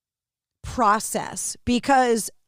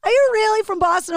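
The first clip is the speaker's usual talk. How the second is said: high-pitched incredulous question; mock disbelief, pitched up; imitated doubting listener; 10 semitones higher, 3 dB louder, faster than usual. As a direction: mimic a listener who can't believe it. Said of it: "they're like".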